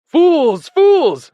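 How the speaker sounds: very high, loud, exasperated cry; furious shout. A narrator's reading. Benjamin berating the animals, shouting at full pitch. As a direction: yell it at a blind crowd. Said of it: "shouted Benjamin".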